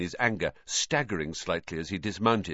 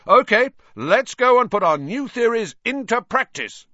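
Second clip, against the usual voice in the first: high, loud rallying shout; 8 dB louder than usual. A narrator's reading. Captain rousing his sleepy team, loud shout. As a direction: call the team out to practice. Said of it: "Wood shouted".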